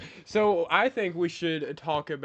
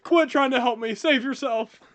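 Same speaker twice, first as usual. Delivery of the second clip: heroic sacrifice; 8 semitones higher, 6 dB louder than usual. Acted.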